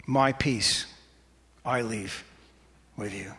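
spaced-out, hushed verse with long pauses; gentle reassurance. Real, not acted. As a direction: settle the room quietly. Gently repeats verse to the congregation.

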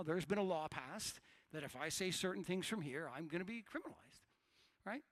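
ordinary delivery

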